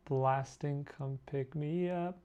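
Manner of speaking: usual speaking voice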